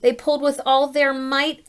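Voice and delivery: ordinary delivery, nothing marked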